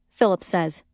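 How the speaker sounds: ordinary delivery, nothing marked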